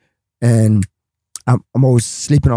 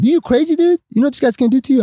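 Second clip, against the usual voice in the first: alarmed friends; 11 semitones higher, faster than usual. Retold or imitated. Promoter friends warning him.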